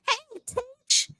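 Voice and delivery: high squeal of a hello; surprised greeting. Real, not acted. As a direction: caught off guard by a sneaky regular. Viewer Teach sneaks into chat.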